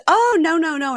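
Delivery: very high, loud opening protest; hammed-up high-pitched dread; mock alarm. Bride hearing of another surprise, mock alarm.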